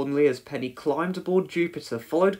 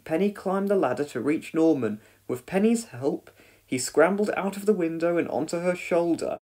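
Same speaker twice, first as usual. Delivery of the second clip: plain reading voice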